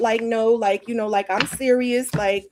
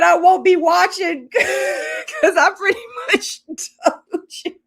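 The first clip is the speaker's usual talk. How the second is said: much higher, louder, laughing through the quote; laughing shout; 9 semitones higher, 5 dB louder than usual. Retold or imitated. Quoting Kimberly, shouting and laughing hard. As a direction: crack up while shouting her line. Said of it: "Kimberly said".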